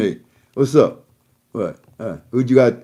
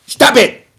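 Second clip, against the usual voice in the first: high mock yell; retold shouted command; imitated yell; 9 semitones higher, 6 dB louder than usual. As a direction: bark it like an angry politician. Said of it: "he was yelling and screaming at the Iranians".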